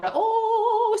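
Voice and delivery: long, high, drawn-out mock wail; loud cartoon "ohhh" of dismay. Cut apple realizing its electrons are broken, high mock-dismayed wail.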